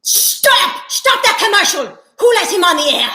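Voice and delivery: high, loud panicked yell; outraged shout. Acted. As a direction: yell to stop the broadcast. Outraged at the commercial.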